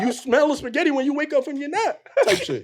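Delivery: high, excited recall. Real, not acted. Recalling waking up to spaghetti, high and excited. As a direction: get hyped about the memory.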